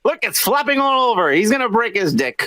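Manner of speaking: high-pitched imitated alarm; mock film-set direction. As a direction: play a director fretting over a mishap. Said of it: "you're gonna be like".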